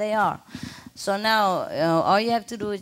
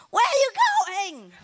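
very high parrot squawk of a question; bird calling out. Retold or imitated. Bird calls busy owner passing by, high squawk. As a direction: call after someone in a piercing bird pitch.

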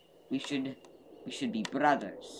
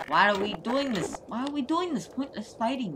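high pleading voice; 9 semitones higher than usual. Acted. Pleading to stop fighting.

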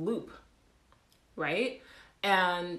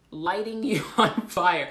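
slow, louder with a real laugh; laughing repeat; 7 dB louder, slower than usual. Real, not acted. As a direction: repeat it laughing at how absurd it is.

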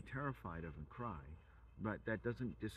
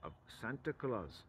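ordinary talk

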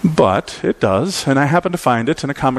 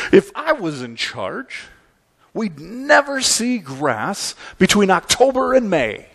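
put-on higher, drawn-out little-boy grumble; slow, higher voice of a put-out kid; little boy Calvin; 6 semitones higher than usual. Preacher voices Calvin waiting for snow, little-boy grumble. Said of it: "little boy Calvin".

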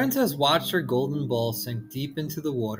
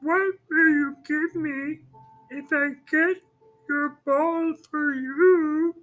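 very high, slow, sly frog; a frog driving a bargain; 14 semitones higher, slower than usual. Acted.

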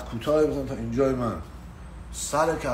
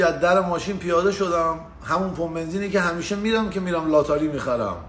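ordinary delivery

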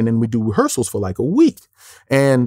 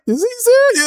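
high-pitched disbelieving question; retold astonishment, voice pitched up; incredulous. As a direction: ask it in amused disbelief. Retells his disbelieving look.

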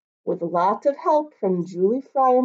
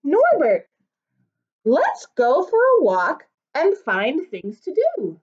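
a mother's bright, raised call; Mama; 6 semitones higher, 6 dB louder than usual. Acted. Mom inviting little Norbert out for a walk, bright and higher. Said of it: "Mama said".

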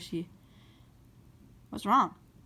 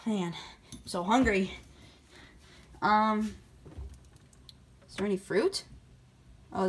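ordinary delivery

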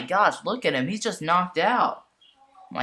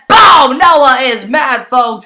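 excited shout; 8 semitones higher, 12 dB louder, slower than usual. Real, not acted. Noah fights back furiously.

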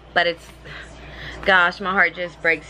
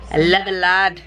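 normal talking voice

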